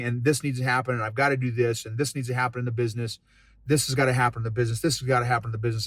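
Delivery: rapid-fire, direct list of demands; short, terse retelling. Retold or imitated. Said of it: "pretty short and direct".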